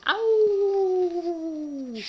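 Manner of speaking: long, high, loud howl; wolf howl. Acted. Wild things at the moon, high drawn-out howl. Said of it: "they're howling at the moon".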